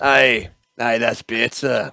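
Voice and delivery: slow Scottish "aye"; Scottish accent. Acted. Interrogator confirming he hears the patient, Scottish accent. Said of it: "I can do Scottish".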